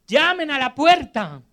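forceful imperative, moderately shouted; shouted command. Retold or imitated. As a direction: call it out as an order. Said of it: "porque el Señor grita".

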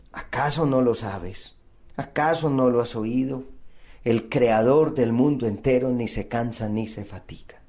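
soft, low, near-whispered reply; hushed answer. Acted. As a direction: drop low and quiet, as God answering a complaint. God's gentle answer after the cry.

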